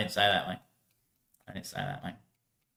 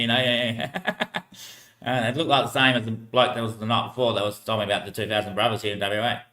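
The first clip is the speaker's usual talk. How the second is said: ordinary delivery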